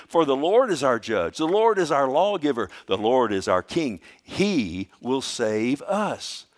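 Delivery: loud, ringing preacher's proclamation of the verse; preaching scripture. Acted. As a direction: proclaim each Lord line to the back pew.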